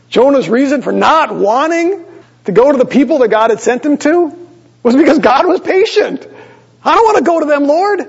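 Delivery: high, complaining prophet voice, rising to a plea on Lord; lightly mocking Jonah's grumble; whiny Jonah. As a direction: play Jonah sulking at God. Preacher voicing Jonah's complaint.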